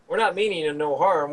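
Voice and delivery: the same line redone with a light Southern twang; lightly Southern, friendly giant; southern giant accent. Acted. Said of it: "In a southern giant accent".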